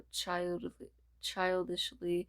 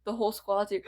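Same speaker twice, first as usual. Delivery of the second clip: louder, crying out; joyful shout; 2 semitones higher, 5 dB louder, faster than usual. Acted. Character shouts joyfully that the squad is here. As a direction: shout it out overjoyed.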